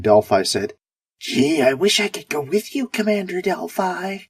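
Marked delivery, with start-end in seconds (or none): 1.2-4.2 high, whiny mock voice; falsetto whine. Commander mocking the Mission Control guy, falsetto whine. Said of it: "In a falsetto whine".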